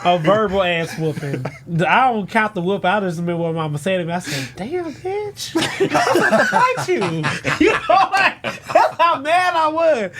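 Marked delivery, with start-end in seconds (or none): 4.6-9.7 laughing. Retold or imitated. Retold angry outburst.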